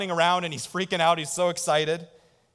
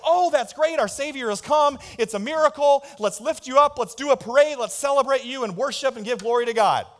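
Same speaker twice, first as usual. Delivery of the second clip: high, loud gushing praise; mock excited celebration; 9 semitones higher, 7 dB louder than usual. Imagined Pharisees cheering the healed man, high loud mock glee. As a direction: ham up an overjoyed welcome. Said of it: "the Pharisees would be like".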